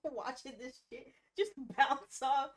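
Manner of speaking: slow, loud words broken by laughter; laughing. Real, not acted. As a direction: laugh through the image. Player laughing at her character.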